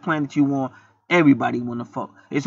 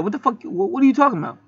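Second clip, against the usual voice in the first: higher, puzzled clerk voice; mock shop clerk; 7 semitones higher, faster than usual. A clerk answering a customer, puzzled and high. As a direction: act confused like the store clerk. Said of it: "they said".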